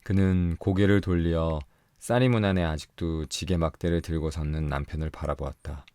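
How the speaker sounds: plain reading voice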